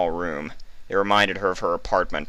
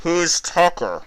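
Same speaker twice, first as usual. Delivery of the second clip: a slow, higher voice over a speaker; static-laden voice; 12 semitones higher, 4 dB louder, slower than usual. A narrator's reading. Hidden interrogator over the speaker, static-laden. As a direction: call out through crackling static. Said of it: "called out a static-laden voice".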